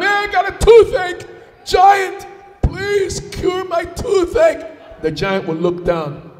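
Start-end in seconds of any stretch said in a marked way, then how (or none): 0.0-5.0 high, loud, drawn-out plea; pleading sufferer. Acted. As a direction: beg the giant loudly in a high voice. Villager begs giant for cure.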